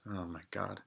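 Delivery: dropped to a near-whisper; under the breath, a quick aside; an aside to the listener; muttered aside. Real, not acted. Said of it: "aside muttered under breath".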